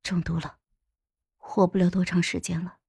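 grave, low and soft. A narrator's reading. Dowager telling dying consort of the poisoning, low and grave. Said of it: "沉声说道".

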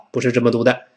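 ordinary talk

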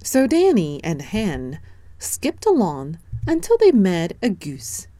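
ordinary delivery

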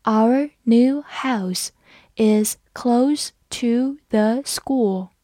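"Our new house is close to the school" read very slowly; slow, careful model reading; slow teacher demo read. Shown as a demonstration. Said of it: "我们慢读一遍".

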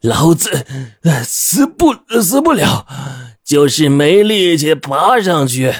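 high, winded voice, words broken by gasps; panting cursing. A narrator's reading. Exhausted man answering from down the cliff, panting and cursing. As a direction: gasp out a gruff answer, dragging the last words. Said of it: "他气喘吁吁地骂道".

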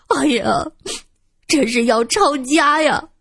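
high-pitched, hammed-up lament; wailing. A narrator's reading. Old woman protesting the search to her companion, wailing. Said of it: "哭嚎着".

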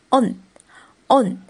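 "on" said alone, closed on n; vowel-final blend. Shown as a demonstration. Teacher blending vowel and final consonant, "on" ending in n. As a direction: say "on" twice with a clear gap. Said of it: "元音和收音拼起来是".

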